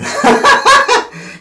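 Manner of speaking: loud high-pitched laugh. Real, not acted. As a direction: burst out laughing. Laughing at own story.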